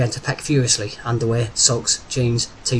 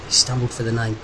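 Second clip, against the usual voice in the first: plain reading voice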